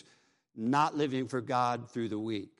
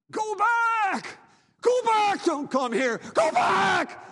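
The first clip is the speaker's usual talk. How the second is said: frantic scream, much higher and louder; desperate warning; 6 dB louder, slower than usual. Acted. Damned man screams to the living, frantic shriek.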